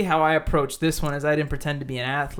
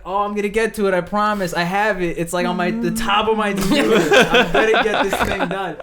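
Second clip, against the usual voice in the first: louder, laughing mock eagerness; hammed-up keen promise to the scammer; eager stalling; 5 semitones higher, 7 dB louder than usual. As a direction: promise too hard, playing dumb. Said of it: "And I'm like".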